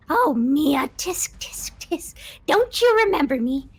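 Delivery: high taunting ghost with a whispered tut; a spirit scolding the girl who left her; shrieking ghost voice. Acted. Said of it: "A shrieking, piercing voice".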